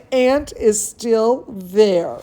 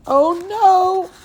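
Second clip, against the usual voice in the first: squeaky mock dismay, much higher; high-pitched oh no; 10 semitones higher than usual. Reading to children, a squeaky high oh no. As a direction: gasp it out high like a kid in a storybook.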